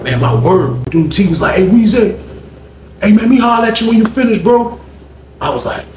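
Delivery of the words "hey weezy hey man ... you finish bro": high-pitched retold holler; pitched-up call for attention; calling over. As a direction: imitate a man summoning his buddy from a distance. Acts out T calling him over.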